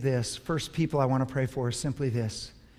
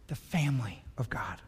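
barely voiced, breath only; whispered; 4 semitones lower, 7 dB quieter than usual. Real, not acted. Pastor to church, whispering after sharing grief. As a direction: let the words fall to a whisper.

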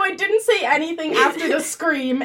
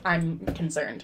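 deadpan; 7 semitones lower, 11 dB quieter than usual. Retold or imitated. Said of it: "she just deadpan".

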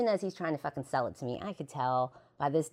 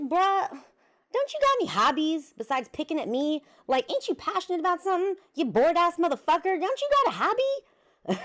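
mock exasperated outburst; 6 dB louder than usual. Retold snapping at bored boyfriend.

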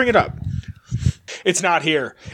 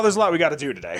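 ordinary delivery

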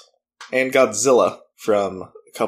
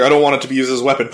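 fast quote; much faster than usual. Retold or imitated. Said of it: "And he's like".

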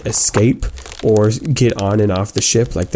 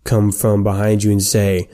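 ordinary talk

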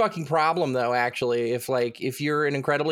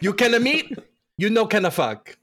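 mock Italian accent; 6 semitones higher, 3 dB louder than usual.

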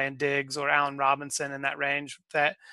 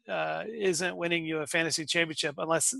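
ordinary talk

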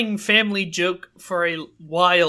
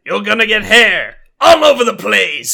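loud, growly monster truck announcer; Guy Stuff voice; 6 dB louder than usual. Acted. Son jokes about the puberty book, Guy Stuff voice. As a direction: boom out the warning like an arena announcer. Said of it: "It's like a monster truck announcer".

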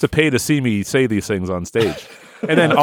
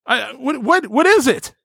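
mock shock; 11 semitones higher than usual. Improv discovery of strange part.

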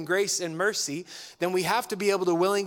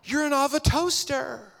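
higher, mock-shocked realisation; mock surprise; 9 semitones higher than usual. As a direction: fake gasp of discovery. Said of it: "You're like".

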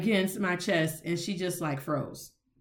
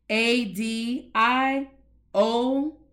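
letters spelled out slowly; slow spelling; 3 semitones higher, 3 dB louder, much slower than usual. Shown as a demonstration. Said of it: "write adios".

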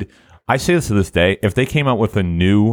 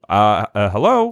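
incredulous hello. Retold or imitated. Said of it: "And I was like".

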